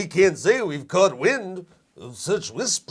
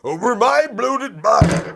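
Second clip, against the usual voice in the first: outraged shout; 8 semitones higher, 8 dB louder than usual. Acted. Indignant outburst at British buyout.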